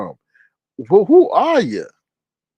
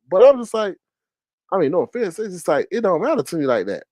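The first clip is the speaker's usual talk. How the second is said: ordinary talk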